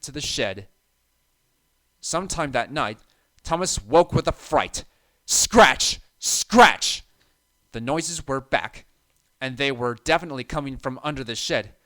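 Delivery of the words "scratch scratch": loud scratching noise. A narrator's reading. Noise returns loudly.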